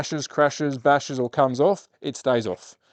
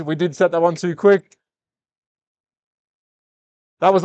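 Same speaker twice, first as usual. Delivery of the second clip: excited race call, higher and louder; race commentary; 6 semitones higher, 6 dB louder than usual. Acted.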